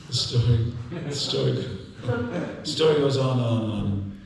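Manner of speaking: building emphasis. Acted. Storyteller building up the repetition.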